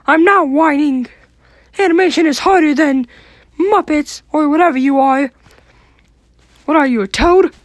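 high squeaky cartoon mouse; squeaky, indignant mouse voice; Mickey Mouse voice.

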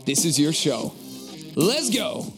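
hyped podcast intro read; big energetic show opener; announcer intro. Acted. Podcast intro read over music.

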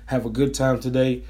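normal talking voice

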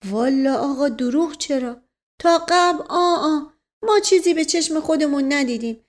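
high, loud comic servant voice; high-pitched rambling servant; protesting servant. Acted. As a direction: protest earnestly, pitched well up. Servant figure protests honesty.

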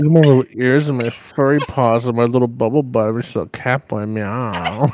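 put-on loud, purring cat-boy act. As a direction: play a needy little cat. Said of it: "Absolutely not".

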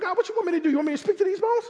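squeaky Ezekiel. Retold or imitated. Preacher voicing Ezekiel doubting God's command, high pleading squeak. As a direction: whine it up high like you can't believe the ask. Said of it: "Ezekiel was like".

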